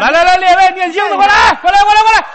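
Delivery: high, loud call across the hall; hammed-up shout for the chanters. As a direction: summon the monks over.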